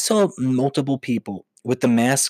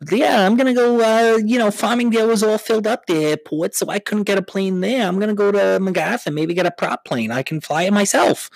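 smug Long Islander; 8 semitones higher than usual. Acted. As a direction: brag about flying out yourself. Acting out a fleeing Long Islander.

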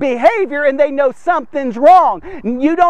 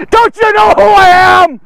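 loud, higher furious bluster; angry boasting shout; 5 semitones higher, 8 dB louder than usual. Retold or imitated. Preacher reenacting his old self before he was saved, loud angry shout. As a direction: bellow it like a man nobody dares touch. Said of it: "that was my mentality at the time".

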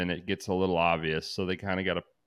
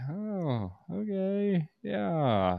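high, drawn-out, unimpressed; lightly voiced bored woman; imitating Gabby; 9 semitones higher, 6 dB quieter, much slower than usual. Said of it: "she's like".

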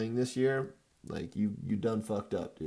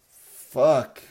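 angry curse; 10 dB louder than usual. Real, not acted. Fantasy team lets him down.

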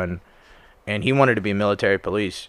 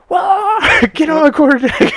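much higher, loud barked order; barked sir yes sir; 8 dB louder, slower than usual. Retold or imitated. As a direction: bark it like a furious drill sergeant. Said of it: "And they're like".

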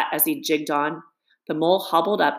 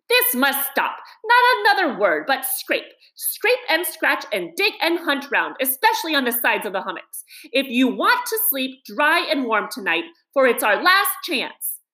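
angry; 7 semitones higher than usual. A narrator's reading. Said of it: "really angry".

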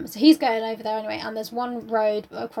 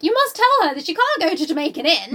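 hammed-up urgent plea; 7 semitones higher, 7 dB louder than usual. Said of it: "he's like to the lady".

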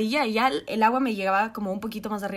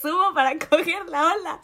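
ordinary talk

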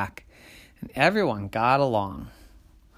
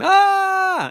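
high, loud panicked shriek; monsters' scream; 10 dB louder than usual. A narrator's reading. Monsters screaming at the big person, panicked shriek. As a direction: scream right back in fright.

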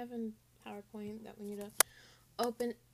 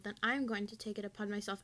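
normal talking voice

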